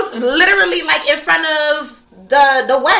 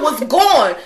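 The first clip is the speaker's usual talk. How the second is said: normal talking voice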